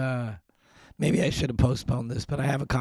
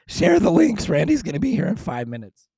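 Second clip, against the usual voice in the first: much higher and louder, calling to viewers; high, loud push to the audience to share; urgent call; 6 semitones higher, 8 dB louder than usual. Real, not acted. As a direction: urge them to share the links loudly.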